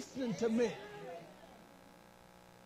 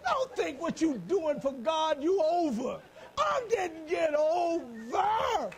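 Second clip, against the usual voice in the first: preaching at full force; 4 semitones higher, 3 dB louder, slower than usual. Real, not acted. Preaching climax.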